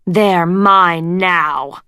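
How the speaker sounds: slow snarled threat. A narrator's reading. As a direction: snarl it slowly. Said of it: "she snarled".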